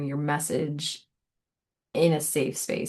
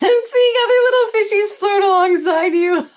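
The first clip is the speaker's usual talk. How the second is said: laughing; 10 dB louder than usual. Real, not acted. Laughing at fish imagery.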